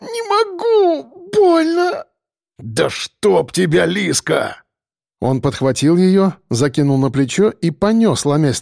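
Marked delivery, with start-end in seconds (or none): 0.0-2.1 very high, drawn-out crying; pained wail. A narrator's reading. Injured woman can't get up, pained tearful wail. 2.6-4.6 slow, drawn-out cursing; growing exasperation, stretched out slowly; exasperated curse. A narrator's reading. Biker curses the fallen woman, exasperated.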